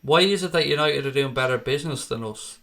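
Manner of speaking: ordinary talk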